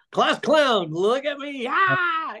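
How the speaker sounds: high, attention-grabbing clown voice; show-off class clown. Retold or imitated. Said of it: "he's going".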